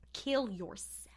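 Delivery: breathy whispered verdict, half under the breath; mock whisper, deadpan; whispered aside. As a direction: drop to a whisper for the punchline. Mock advice voiced to John.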